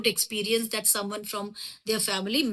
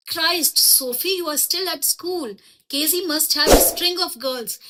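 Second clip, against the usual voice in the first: higher, scoffing disbelief, first words drawn out slowly; mocking brother; 5 semitones higher than usual. Geoff scoffing at his sister's story, higher mocking voice. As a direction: tease her like a know-it-all big brother.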